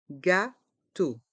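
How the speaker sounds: "Gâteau" in two separate beats; learner-paced, split "Gâteau"; syllable by syllable. Shown as a demonstration. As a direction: break "Gâteau" into gâ and teau. Teacher breaks word into syllables.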